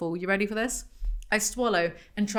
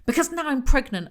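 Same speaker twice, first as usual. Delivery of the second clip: high, loud exasperated outburst; inner scream; 7 semitones higher, 6 dB louder than usual. A narrator's reading. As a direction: snap it at the assistant in exasperation. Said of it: "I scream at her in my head".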